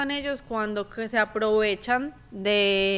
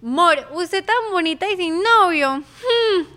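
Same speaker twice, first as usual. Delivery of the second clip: imitating a kid; 5 semitones higher, 7 dB louder, slower than usual. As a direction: play a kid's cheeky serenade, pitched up. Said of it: "una vez un niño dijo". (niño coqueto imitado, agudo y fuerte)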